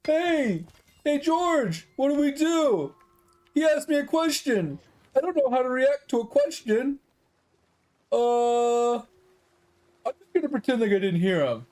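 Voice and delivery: mocking, high, dopey employee impression; clueless employee. Employee calling to coworkers over a simple customer question, clueless mockery. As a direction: act out a baffled worker, high and drawn out in places. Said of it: "look at him like he's a fucking piece of meat and just like".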